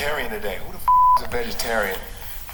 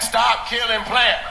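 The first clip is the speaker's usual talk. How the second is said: mocking meat eater; 7 semitones higher than usual. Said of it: "Meat eaters would be like".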